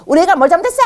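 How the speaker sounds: high, loud indignant outburst; confrontational parent. Acted. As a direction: act out an angry parent challenging the teacher. Parent confronting teacher, acted.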